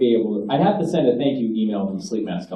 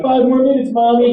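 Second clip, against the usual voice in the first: put-on higher, whiny kid begging to sleep; mock child pleading with mom; sleepy child; 10 semitones higher, 4 dB louder than usual. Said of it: "You're like".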